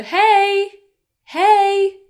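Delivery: high, loud, sustained call; friendly greeting. Shown as a demonstration. Teacher greeting people known quite well, friendly high call.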